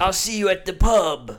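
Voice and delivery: loud put-on English farewell; hammed-up English; English accent. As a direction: call out like an English pub regular. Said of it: "He knew pub was English".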